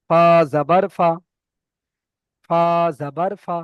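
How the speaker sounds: ফা জাবার ফা, loud with ফা short; short vowel reading. Shown as a demonstration. Teacher to students, short vowel drill. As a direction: keep ফা clipped, no stretch. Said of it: "এখানে একদম টান হবে না".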